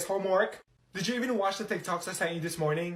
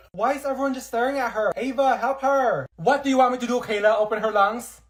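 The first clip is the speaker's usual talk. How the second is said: panicked yelling; 3 semitones higher, 6 dB louder than usual. Acted. Panicked argument over sick friend.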